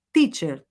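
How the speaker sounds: teacher with a pure T, "tee"; correct teacher. Shown as a demonstration. Teacher modelled for students to repeat, with a pure T. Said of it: "o som correto".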